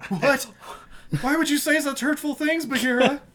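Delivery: high, whiny hammed-up hurt voice; mock hurt Mowgli. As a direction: play the betrayed child, over the top. Voicing a wounded Mowgli.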